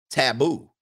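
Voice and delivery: normal talking voice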